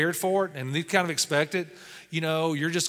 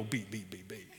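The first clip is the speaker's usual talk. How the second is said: laughing beeps; 8 semitones lower, 14 dB quieter than usual. Pretend. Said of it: "If I was trying to be offensive".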